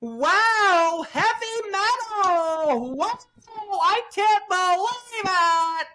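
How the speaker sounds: very high, slow, squealing puppet-like announcer; squeaky over-the-top announcer amazement. Retold or imitated. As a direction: gush like a wide-eyed puppet host. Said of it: "and she's like".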